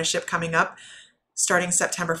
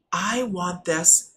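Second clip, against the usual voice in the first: slow want this; much slower than usual. Shown as a demonstration. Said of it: "You guys hear me say this all the time".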